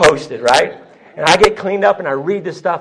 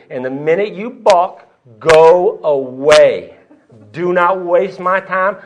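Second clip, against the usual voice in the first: very slow, emphatic warning; drawn-out, forceful sponsor's order; stern ultimatum; 7 dB louder, much slower than usual. Retold or imitated. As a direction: lay down the law, word by word.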